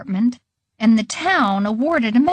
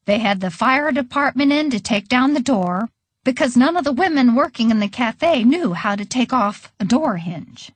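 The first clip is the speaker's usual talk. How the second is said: plain reading voice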